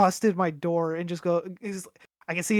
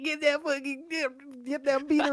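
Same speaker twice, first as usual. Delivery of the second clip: dumb gamer yelling; 8 semitones higher than usual. Retold or imitated. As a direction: imitate a dumb player screaming orders, pitched way up. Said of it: "he's just getting like".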